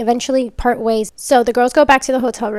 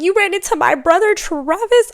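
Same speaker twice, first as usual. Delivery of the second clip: much higher and louder, rising at the end; can't believe what he just heard; shocked disbelief; 9 semitones higher, 5 dB louder than usual. Retold or imitated. Trevor learning his estranged brother is at the hotel, shocked.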